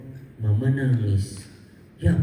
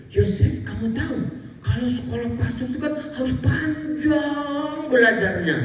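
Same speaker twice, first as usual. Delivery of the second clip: high, sung-out aunt voice teasing; singsong doubting aunt; 12 semitones higher than usual. Retold or imitated. Neighbourhood aunt doubts Yosef can study, high singsong. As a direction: lilt it like a doubtful auntie.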